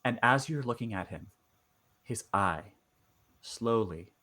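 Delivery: game master narration. Acted. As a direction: draw out each eerie detail quietly. Game master sets eerie scene.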